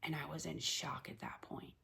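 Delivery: whispered, stunned; numb whisper. Real, not acted. Mother recalling the stillbirth news, whispering in shock. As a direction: let it fall to a breath, numb. Retelling news of baby's death.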